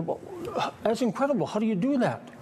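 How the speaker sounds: high, amazed exclamation; amazed woman. Retold or imitated. Amazed zoo visitor in joke.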